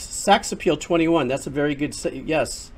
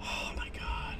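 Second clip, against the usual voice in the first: breathless whispered disbelief; stunned whisper; 14 dB quieter, slower than usual. Real, not acted. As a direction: barely get the words out, in shock. Stunned after near death.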